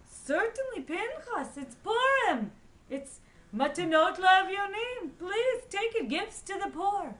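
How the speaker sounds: high, loud, eager reply; delighted generosity. A narrator's reading. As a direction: gush with eagerness to give. Shopkeeper gladly offers gifts.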